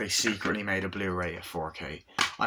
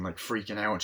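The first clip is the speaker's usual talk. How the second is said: normal talking voice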